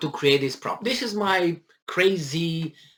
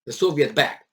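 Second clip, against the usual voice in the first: normal talking voice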